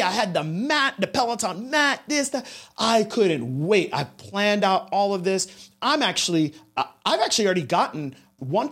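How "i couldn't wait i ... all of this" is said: ordinary talk, nothing marked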